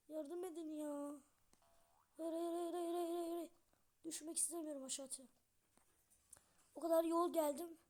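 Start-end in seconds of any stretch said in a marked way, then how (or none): 2.2-3.5 rapid pleading hayır repeated; panicked no. Real, not acted. Gamer about to fall, panicked pleading. Falling in game. 6.7-7.8 loud exasperated cry; frustrated outburst. Real, not acted. Gamer losing his climb, loud frustration. Fell after long climb.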